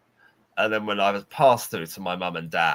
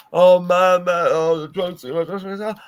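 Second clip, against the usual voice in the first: higher, louder pitiful moan; miserable drunk groan; 10 semitones higher, 8 dB louder than usual. Retold or imitated. Drunk Tom groaning to Eddie about drinking too much. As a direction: whine like you're about to be sick.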